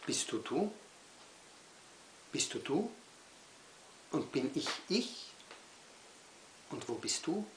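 isolated questions, rising ends; questioning poem recital. Acted. Poet asks children who and where, questioning recital. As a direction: leave a long pause after each question.

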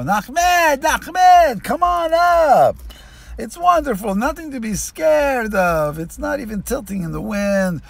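very high, loud pleading calls, slowing down on come on up. Retold or imitated. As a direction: coax him up at full volume. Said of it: "he's starting to yell some more".

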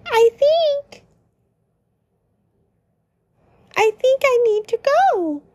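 high voice then a fast rush; downcast Lancer. Acted. Lancer making an excuse to leave, downcast and hurried. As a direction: blurt out leaving quickly. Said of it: "Lancer is frowning".

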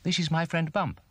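high, chirpy bird voice; whistling bird. A narrator's reading. Birdie introduces Bump to the owl, whistling. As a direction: introduce a friend, high and birdlike. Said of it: "Birdie whistled".

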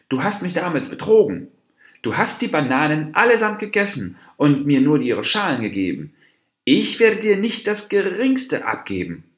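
high, loud, indignant outburst; angry accusation. A narrator's reading. Dwarf deer accuses the monkey who cheated him, angrily.

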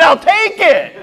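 high, quick eager grab; eager I'll take it. Retold or imitated. To the farm owner, eagerly claiming the yak. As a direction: jump at the offer, excited.

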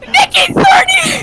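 high, starstruck shout; real high-pitched fan yell; loud fan excitement. Real, not acted. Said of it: "I'm really loud right now".

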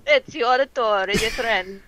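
put-on over-the-top Italian accent. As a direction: roll it out like an Italian. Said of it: "Holy shit, you're good at Italian".

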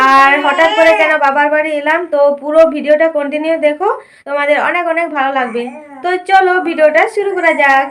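loud, lively teaser for the video; upbeat vlog intro. Acted. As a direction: hook the viewers with energy.

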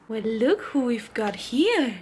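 normal talking voice

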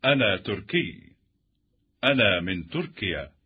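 usual speaking voice

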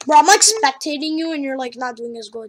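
ordinary talk

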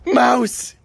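shrill-free, high and loud cry of mouse; high excited yell at the dog; high excited scream. Real, not acted. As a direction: scream the word to rile the dog. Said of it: "I'm screaming".

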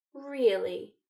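drawn-out, intrigued musing with a lifted pitch. A narrator's reading. Malfoy toying with Hermione after her answer, musing. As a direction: muse slowly, as if savouring a curiosity. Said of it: "he said in a musing tone".

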